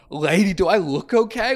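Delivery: mock-indignant inner retort; incredulous retort. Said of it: "in my head, I'm like".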